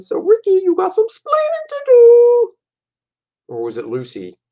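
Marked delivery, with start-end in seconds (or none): 0.2-2.5 Cuban accent impression. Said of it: "talk with an accent like that".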